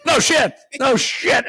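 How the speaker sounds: no shit, shouted in disbelief; exasperated shout. Real, not acted. Telling his cohost about the lecture, exasperated shout. Mocking the seminar speaker.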